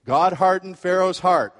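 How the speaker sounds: a listener's triumphant objection, loud; objector's proof text. Pretend. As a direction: quote "hardened" like a trump card. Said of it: "I'll anticipate it".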